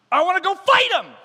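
high, loud outburst; shouted gung-ho plea; boyish eagerness. Acted. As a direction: blurt it out like an eager kid. Eager boy David tells king.

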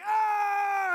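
high loud yelp of pain; imitated old man's shout of pain; pained cry. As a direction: cry out as the hand is crushed. Old man's hand squeezed painfully.

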